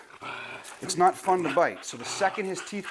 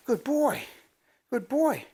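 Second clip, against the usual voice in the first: higher praise; puppy praise; 8 semitones higher, slower than usual. Real, not acted. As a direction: praise the dog.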